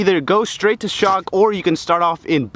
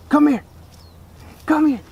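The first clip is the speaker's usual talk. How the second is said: calling a dog; 9 semitones higher than usual. Real, not acted.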